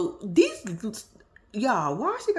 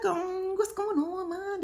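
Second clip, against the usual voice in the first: imitated happy man; 10 semitones higher, slower than usual. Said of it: "He was like".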